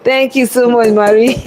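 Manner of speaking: thanking through bursts of laughter; real laughing thanks. Real, not acted. Chef thanks viewer for praising her French, laughing. Chef amused by compliment on accent.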